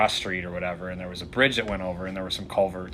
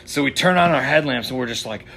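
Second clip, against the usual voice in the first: ordinary talk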